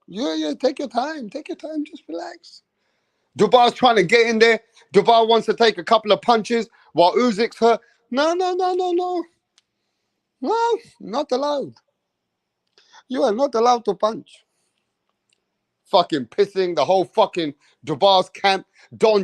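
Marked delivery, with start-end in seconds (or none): 0.1-2.6 high, soft, fading to a whisper; mock soothing referee. Ranter mocks the referee coddling Usyk, high and hushed to a whisper. 8.1-14.4 high, prissy no-no-no, slowing on not allowed; mock referee refusal. Mocking referee blocking Dubois.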